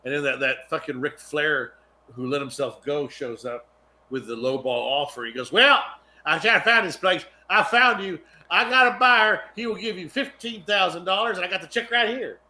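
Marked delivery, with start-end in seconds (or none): 5.5-12.4 loud, high southern twang; hammy salesman with a southern twang; John Goodman southern twang. Acted. Lowballer offering to take the bike, southern twang. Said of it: "He sounded like John Goodman".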